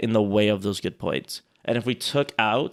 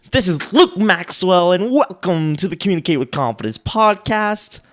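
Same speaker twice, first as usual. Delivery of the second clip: podcast voice; 7 semitones higher, 9 dB louder than usual. Shown as a demonstration. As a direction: open the show like a radio host. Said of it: "That's my podcast voice".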